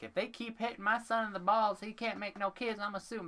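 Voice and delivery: very high mock-angry rant; comically shrieky angry dad; imitating pissed-off dad. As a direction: whine it like an outraged father. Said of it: "he was getting so pissed".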